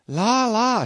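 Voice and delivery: high, shrill-free cry of a startled maid; chambermaid crying out. A narrator's reading. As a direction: throw up a high alarmed cry. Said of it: "she cried".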